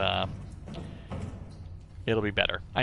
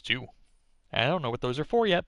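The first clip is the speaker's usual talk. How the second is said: ordinary talk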